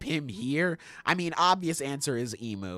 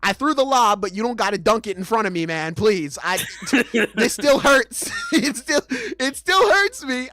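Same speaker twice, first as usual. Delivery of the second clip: louder and higher, strained and laughing; pained jealous plea; 6 semitones higher, 6 dB louder than usual. Acted. [name] telling the couple to calm down, loud strained begging. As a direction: beg them to cool it, voice cracking with jealousy.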